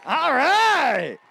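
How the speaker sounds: excited. Real, not acted. Emcee hyping the crowd.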